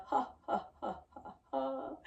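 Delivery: string of breathy ha's, fading out; staccato exhaled ha, tailing away; puffed ha breaths. Shown as a demonstration. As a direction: puff out ha in short pulses.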